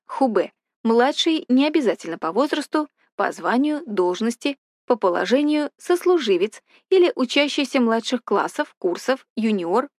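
usual speaking voice

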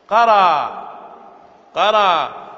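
قرأ called out loud and firm; loud example. Shown as a demonstration. Teacher calls out the example قرأ loudly to students. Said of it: "نحو".

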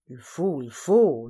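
low-pitched grumble; discontented servant muttering after an order; disgruntled murmur. A narrator's reading. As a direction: grumble resentfully to yourself. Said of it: "murmura le cocher mécontent".

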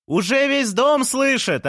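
very high, drawn-out holler; calling across balconies. A narrator's reading. Neighbours answering from another balcony, high drawn-out call. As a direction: sing it out slowly to the neighbours, far up in pitch. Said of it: "ответили жильцы с другого балкона".

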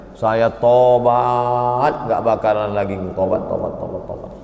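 drawn-out whiny repenting; lightly hammed-up pleading; mock pleading. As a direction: beg for forgiveness, stretching tobat. Begging repentance in retold scene.

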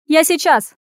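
high, loud, eager call; shouting. A narrator's reading. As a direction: call out as you dash off. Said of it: "крикнула она".

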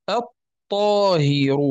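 الطاهر very slow, "at...taahiru"; assimilated lam. Shown as a demonstration. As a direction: hold the doubled ta in الطاهر. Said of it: "هذا في الطاء".

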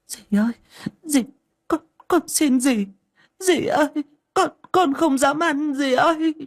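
put-on high, pleading child's cry; begging terrified. Kneeling girl begging her stepmother, terrified. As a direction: beg for mercy on your knees. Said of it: "quỳ xuống chắp tay khấn".

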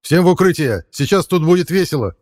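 high, loud shouted warning; shouting. Acted. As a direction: yell it to the whole squad. Fighter warns squad over radio.

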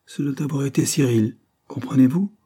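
ordinary delivery

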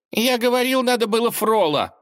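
whiny advisor. Acted. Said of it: "влез с неуместным замечанием".

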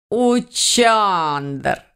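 delighted cry, drawn out; joyful exclamation. A narrator's reading. Grandmother greets the cat she knows, joyful exclamation. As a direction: call the name with a big smile. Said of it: "воскликнула бабушка, расплываясь в радостной улыбке".

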